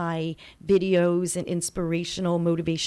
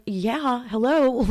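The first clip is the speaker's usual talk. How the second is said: mock-obvious hello, a touch louder and higher; tossing back an obvious answer; sarcastic hello; 5 semitones higher, 3 dB louder, slower than usual. Answering people who question her openness, with a sarcastic hello.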